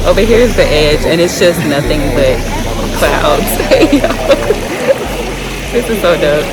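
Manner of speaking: excited. Real, not acted. In awe at the sky-high view.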